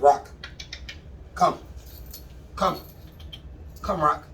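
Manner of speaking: spaced-out firm calls to the dog; calling the dog. Real, not acted. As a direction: call the dog in, pausing between commands. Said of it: "I say it multiple different ways".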